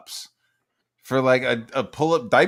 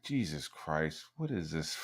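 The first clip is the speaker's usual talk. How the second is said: mock horrified mutter; 8 semitones lower, 14 dB quieter than usual. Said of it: "I'm like".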